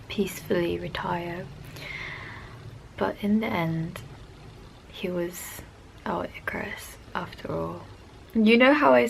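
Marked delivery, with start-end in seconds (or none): 0.1-7.8 soft, sorrowful, fading to a whisper; sad. Real, not acted. Fan mourning her idol's passing, softly sad. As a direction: let it sink into grief.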